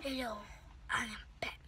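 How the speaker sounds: breathy whisper. Real, not acted. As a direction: whisper it like a secret. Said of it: "why you whispering".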